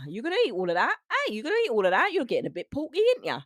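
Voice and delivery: loud, high-pitched Cockney nagging; exaggerated Cockney, voice swooping upward; Cockney accent. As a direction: scold like a put-on Cockney parent. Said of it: "Cockney accent, Cockney rhyming slang".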